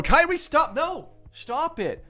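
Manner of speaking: squeaky high stop it; high-pitched protest. Real, not acted. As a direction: whine stop, high and pleading. Protesting a viewer joke.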